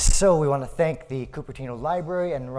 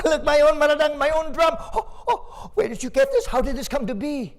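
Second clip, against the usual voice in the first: put-on excited child; 12 semitones higher than usual. Boy thrilled at his mother's gift, high and excited. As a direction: gasp with joy at the gift.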